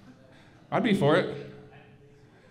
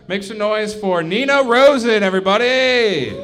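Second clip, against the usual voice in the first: raised, louder stage announcer call; emcee introduction; 5 semitones higher, 5 dB louder than usual. Real, not acted. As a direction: build up the act and call her out to the crowd. Host introduces next comedian on stage.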